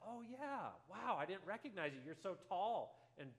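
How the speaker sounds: acted pleasant surprise, pitch leaping high; delighted recognition. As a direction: exclaim as you suddenly recognize someone. Pastor suddenly recognizes grown boy.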